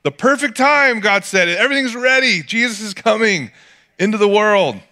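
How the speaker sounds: high, bright exclaiming; excited, perfect time. Retold or imitated. Pastor voicing God's perfect timing, high excited exclaim. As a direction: announce that everything's finally ready.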